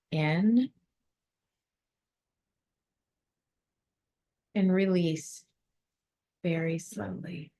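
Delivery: calm, measured guidance with long silences; guided meditation. Acted. Leads a breathing visualization.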